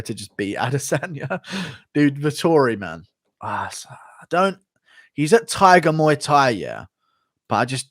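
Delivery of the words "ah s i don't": ordinary talk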